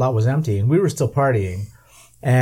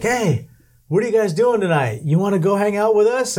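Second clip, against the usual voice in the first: friendly soldier's invitation; 8 semitones higher than usual. Retold or imitated. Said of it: "and they were like".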